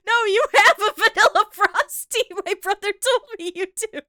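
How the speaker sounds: high, laughing mock insistence; laughing quote. Laughing as she voices the uncle insisting at the drive-thru. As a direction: insist while cracking up.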